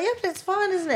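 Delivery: high, forced brightness. Retold or imitated. She covers the awkward moment with a high cheerful chirp. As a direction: chirp it to smooth things over. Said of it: "I was like".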